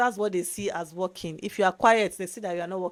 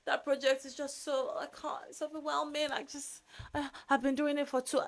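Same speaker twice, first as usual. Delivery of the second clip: breathy, higher, much softer overwhelmed moan; mock complaining; 7 semitones higher, 7 dB quieter than usual. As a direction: play up how swamped you are, half whispered. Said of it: "later he started complaining".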